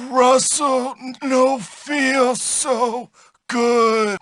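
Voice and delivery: slow, woozy groaning brute; beaten and hurting. Acted. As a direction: moan it out slowly, sick and defeated. Bully beaten, groaning in defeat.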